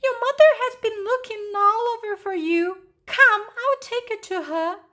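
high-pitched little bird. A narrator's reading. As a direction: urge them along in a high bird voice. Bird character speaks to lost chicken.

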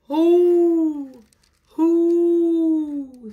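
high, loud drawn-out hoot; owl hoot. Acted. Reading to children as the owl, hooting. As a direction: hoot it like a big round owl. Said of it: "said a big round owl".

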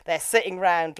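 usual speaking voice

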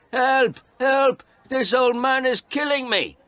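very high, loud panicked yell; shrieked alarm; shouting for help. A narrator's reading. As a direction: cry out as if being attacked. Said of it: "He shouted".

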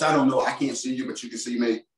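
ordinary delivery

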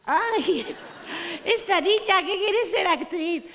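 put-on a fussy old neighbor clucking over the girl; pitying old lady. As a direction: stretch it out with mock pity. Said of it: "así maquillada como Lexiomo".

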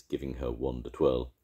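plain reading voice

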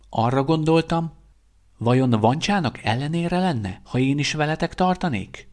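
put-on a young man asking shyly to join; hopeful young guard. A narrator's reading. Young guard asking to join the mission, hopeful and tentative. Veszter asks to come.

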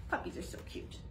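soft unvoiced whisper; cosy whispered aside. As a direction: hush it like a secret to the kids. Aside to children after story.

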